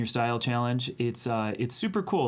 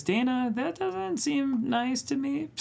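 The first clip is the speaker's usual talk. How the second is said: high, slow mock-hurt voice; 13 semitones higher, slower than usual. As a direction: play wounded in a high voice. Mock-hurt reply to chat.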